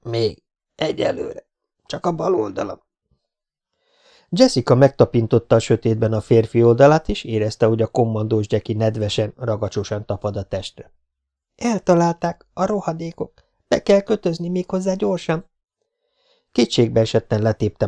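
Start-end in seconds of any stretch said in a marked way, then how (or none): 0.0-2.8 wounded man. Acted. Wounded man answers through pain. 11.6-15.5 high-pitched distressed exclamation; alarmed urgency. Acted. Girl alarmed at his wound.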